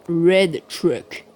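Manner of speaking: showy English accent on "Red Truck". Shown as a demonstration. Player boasts of their English, English accent. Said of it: "Admirez mon accent en anglais".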